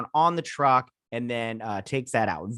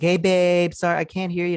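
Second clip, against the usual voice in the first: higher, raised-pitch call to a partner; imitated phone greeting; 6 semitones higher, 4 dB louder than usual. Husband on a call with his wife, imitated greeting. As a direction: act out answering a call you can't hear.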